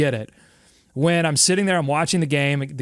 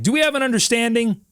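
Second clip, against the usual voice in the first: lightly voiced dad, pitched up; a hint of a dad demanding agreement; stern dad; 6 semitones higher than usual. Dad lays down the law to his kid, lightly voiced stern dad. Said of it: "that dad that says".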